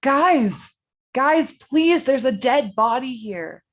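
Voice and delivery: high, loud desperate yelling; panicked shout. Acted. Jimmy shouting at laughing friends about the dead body, panicked. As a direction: shout over them, begging them to listen.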